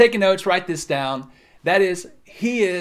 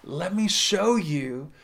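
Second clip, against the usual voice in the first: tender God; slower than usual. Acted. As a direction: gently lift a child back up. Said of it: "God comes along and he says".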